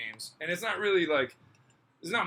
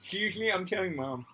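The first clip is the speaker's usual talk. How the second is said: put-on high whiny kid tattling; whiny tattling little sibling; 5 semitones higher than usual. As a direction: whine like a kid telling on you.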